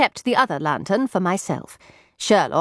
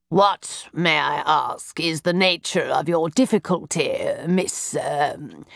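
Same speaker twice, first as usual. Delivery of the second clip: lightly lowered stuffy gentleman voice; a hint of a haughty older brother; Mycroft, pompous elder brother; 3 semitones lower than usual. A narrator's reading. Mycroft querying a stranger in the cab, lightly stuffy.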